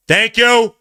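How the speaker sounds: high, loud sarcastic thanks; real exasperated yell of thank you. Real, not acted. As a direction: shout thank you up at the refs. Repeats the thanks louder.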